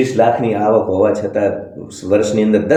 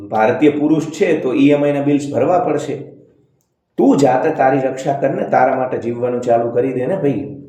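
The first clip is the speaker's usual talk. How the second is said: ordinary delivery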